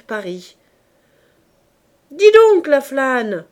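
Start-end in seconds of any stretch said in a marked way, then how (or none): 2.1-3.4 loud, high angry yell; a roofer yelling furiously at his idle apprentice. Acted. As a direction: bawl him out, fast. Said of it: "dit Coupeau furieux".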